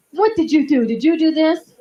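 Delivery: high, loud scolding of a pet; scolding the dog. Retold or imitated. Owner scolding the dog over the carpet, loud and high. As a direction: scold the dog like a naughty child.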